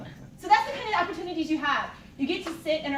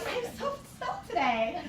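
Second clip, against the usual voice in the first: smug mock; 4 semitones higher, slower than usual. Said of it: "you're like".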